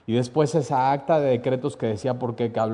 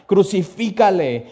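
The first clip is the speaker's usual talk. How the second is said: loud, slow cry of Crucifícale; crowd shouting; 6 semitones higher, 6 dB louder than usual. Retold or imitated. Preacher voicing the crowd shouting again, loud slow yell. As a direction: shout it like the mob once more. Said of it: "ellos gritaban aún una vez más".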